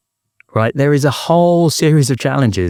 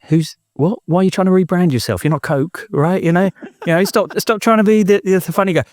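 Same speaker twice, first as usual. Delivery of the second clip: higher-pitched mock disbelief, imitating ribbing mates; mates' teasing protest; 8 semitones higher than usual.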